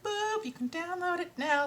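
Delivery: very high little pop-up voice; chirpy notification. Retold or imitated. Website announcing the manuscript download, high chirpy voice. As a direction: imitate a computer alert, high-pitched.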